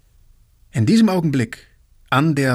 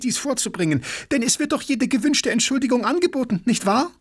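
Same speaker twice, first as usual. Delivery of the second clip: high-pitched, eager pleading; earnest involvement; 9 semitones higher, faster than usual. A narrator's reading. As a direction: argue keenly, rising higher as you go. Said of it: "mit solcher Beteiligung sprach". (mit lebhafter Beteiligung)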